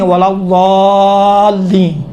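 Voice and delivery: very slow ولا ضالین with heavy ض and long-held vowel; thick correct ض. Shown as a demonstration. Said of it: "موٹا بنائیں".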